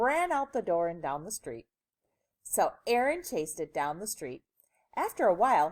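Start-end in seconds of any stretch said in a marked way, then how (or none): none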